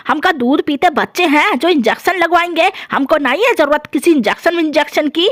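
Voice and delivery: a cranky dadi just woken up, scolding; grumpy old grandmother. Acted. Grandmother refuses injection. (गुस्सैल बूढ़ी दादी की आवाज़)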